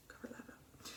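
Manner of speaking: breathy whisper under the breath; whispered aside. Real, not acted. Vlogger covering her forehead, whispering to herself. Muttered aside while applying makeup.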